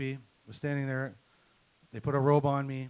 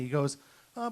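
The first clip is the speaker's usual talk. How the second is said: ordinary delivery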